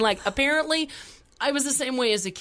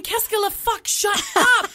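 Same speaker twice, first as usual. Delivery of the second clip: exasperated inner outburst; 9 semitones higher, 5 dB louder than usual. Retold or imitated. Inner reaction to son at police stop.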